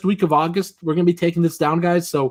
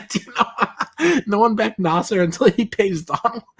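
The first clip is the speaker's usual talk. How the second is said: laughing; 6 semitones higher than usual. Real, not acted. Reading chat while laughing.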